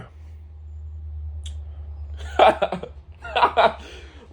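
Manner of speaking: silent wheeze of laughter; breathy wheezing laugh. Real, not acted. Long airy laugh.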